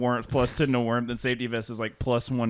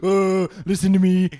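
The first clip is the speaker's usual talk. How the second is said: higher, louder, theatrical woe; mock self-pity; 6 semitones higher, 7 dB louder than usual. Friend mocking his own sob story, hammed up.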